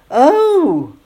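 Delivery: high, loud gasp of surprise; exclaimed. A narrator's reading. Jonathan and Nancy recognise each other, surprised exclamation. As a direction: cry out in astonishment. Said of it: "both Jonathan and Nancy exclaimed".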